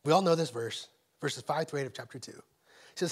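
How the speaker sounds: ordinary talk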